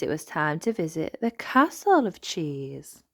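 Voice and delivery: plain reading voice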